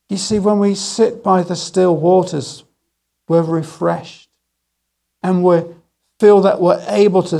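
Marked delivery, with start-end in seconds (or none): none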